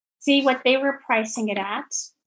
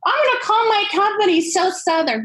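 excited decision; 10 semitones higher, 4 dB louder than usual. Retold or imitated. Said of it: "So I figured".

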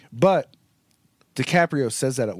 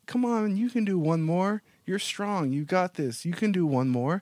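encouraging; 8 semitones higher than usual. Retold or imitated. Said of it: "And she's like".

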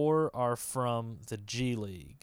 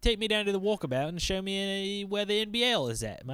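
mock Australian accent; 8 semitones higher than usual. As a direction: play a cartoon Aussie bloke calling out "mate". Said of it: "hey, Australian listeners out there".

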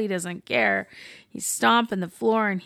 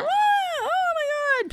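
high-pitched mock scream of a crowd; mock panic. Voicing people fleeing the bursting dragon, mock-panicked cry.

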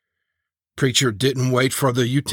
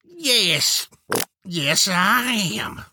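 high, slow, stretched agreement; drawn-out relief; 9 semitones higher, much slower than usual. Acted. Preacher answering Turtle Dove's compliment, high drawn-out satisfaction. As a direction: draw it out high and self-satisfied.

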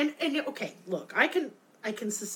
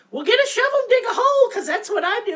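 higher, louder and fast, fed up; exasperated rant; 12 semitones higher, 9 dB louder, faster than usual. Real, not acted. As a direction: shout the obvious fix at the screen. Ranting about the characters dying.

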